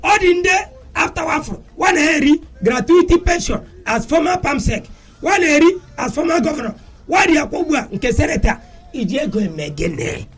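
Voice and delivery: high-pitched, heated tirade, shouting on key words; impassioned shouting rant. Real, not acted. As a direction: rail against the governors with rising fury. Passionate rant against governors.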